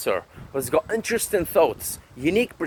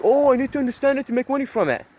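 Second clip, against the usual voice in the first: mocking parody; 7 semitones higher, faster than usual. Mocking the naive mindset.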